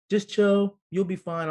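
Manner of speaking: casual brush-off. Retold or imitated. Doctor about to get coffee, casual brush-off. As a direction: imitate a doctor waving off the worry.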